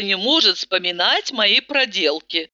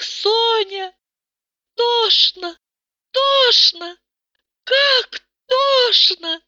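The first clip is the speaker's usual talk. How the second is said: much higher, slow anguished wailing; Tata, hysterical; 12 semitones higher, slower than usual. A narrator's reading. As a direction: melodramatic despair. Tata's hysterical outburst.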